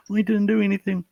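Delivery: mocking whiny denial. Mocking people who deny everything, high whine. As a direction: parody a childish denial. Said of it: "people start".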